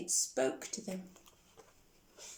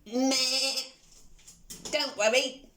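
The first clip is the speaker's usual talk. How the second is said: bleating sheep, louder; 3 dB louder, slower than usual. Acted. Sheep reassures Pig.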